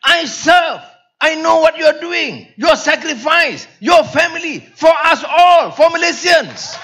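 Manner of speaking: imitated passionate rebuke rising to a shout; angry Karpal Singh. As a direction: imitate a friend's heated lecture, high, ending in a shout. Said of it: "Jadi dia marah".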